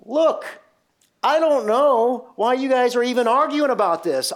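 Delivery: high, loud imitation of a fed-up caller; exasperated radio caller. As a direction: mimic a caller cutting into an educated debate. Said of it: "the caller, you could just tell, did not have the education".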